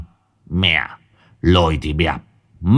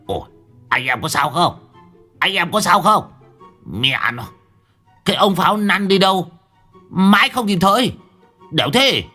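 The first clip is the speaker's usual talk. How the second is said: shouting; 9 semitones higher than usual. Acted. Old Đồng shouting to workers in the dark. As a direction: yell for the lads while groping around. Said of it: "mà gào lên".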